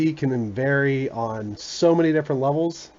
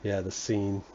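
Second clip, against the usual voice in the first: ordinary talk, nothing marked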